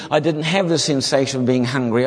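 usual speaking voice

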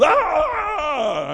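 high imitated shriek of waking fright; mock startled scream. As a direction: shriek like a wife startled awake. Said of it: "she wakes up and goes".

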